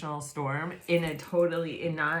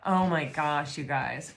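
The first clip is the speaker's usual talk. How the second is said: usual speaking voice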